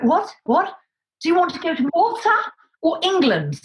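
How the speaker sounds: high bewildered what; confused surprise. Retold or imitated. Answering the unexpected call, high bewildered surprise. Stunned by the sudden job offer.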